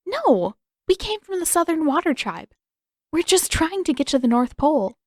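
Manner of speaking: put-on girl protesting to captors she's no spy; urgent protest. A narrator's reading. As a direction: insist you're innocent. Katara protesting innocence to captors.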